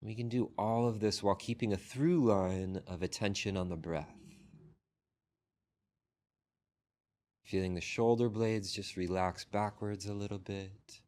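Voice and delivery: unhurried meditation guidance with long pauses; guided meditation. Real, not acted. Teacher guiding a group into breath and body scan, unhurried meditation voice. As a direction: lead the sitters gently, phrase by phrase, leaving long silences.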